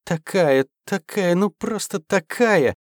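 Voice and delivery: put-on high, sing-song valley girl chirp; mannered chirping. A narrator's reading. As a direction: chirp it like a spoiled girlfriend. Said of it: "защебетала манерным голосом".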